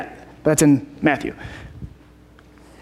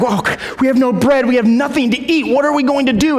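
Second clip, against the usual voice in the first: higher-pitched, imitated worry; acted-out panic, pitched up; mock freaking out; 6 semitones higher than usual. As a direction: fret like a worried group, hammed up. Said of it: "they're worrying and they're freaking out".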